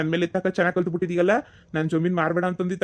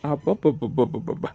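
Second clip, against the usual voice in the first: mocking exclamation; 5 semitones lower than usual. Brother mocks sisters' pride.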